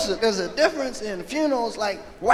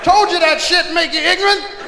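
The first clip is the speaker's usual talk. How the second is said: mad grandmother; 7 semitones higher than usual. Acted. Grandmother learning what the cocaine cost, imitated angry scolding. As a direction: scold hard in an acted grandmother's fury. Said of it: "She got mad".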